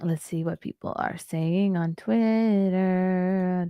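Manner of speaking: drawn-out slow reading; slow drawl. Acted. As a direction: stretch every word out slowly. Drawn out against the later take.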